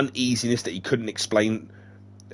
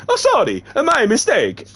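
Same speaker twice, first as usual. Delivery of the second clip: high, loud apologetic character voice; Dead Man voice; 14 semitones higher, 6 dB louder than usual. Acted. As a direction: blurt the apology in a high put-on voice.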